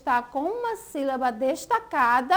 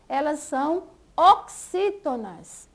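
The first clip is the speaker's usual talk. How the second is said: ordinary talk, nothing marked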